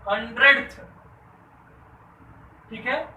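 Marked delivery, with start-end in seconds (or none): 0.1-0.8 loud "हंड्रेडथ", rising onto the final th; stressed th ending. Shown as a demonstration. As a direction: punch "हंड्रेडथ" with th added at the end. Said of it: "टीएच लग जाता है". ("हंड्रेडथ" ज़ोर से, अंत में थ जोड़कर)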